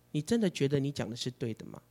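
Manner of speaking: whispered doubting lie. Retold or imitated. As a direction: let the lie creep in quietly. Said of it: "对我说". (谎言在耳边低声质疑)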